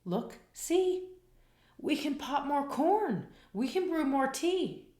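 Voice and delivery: high soothing little mouse voice; squeaky mouse. A narrator's reading. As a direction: squeak gently to comfort the bear. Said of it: "Mouse squeaks".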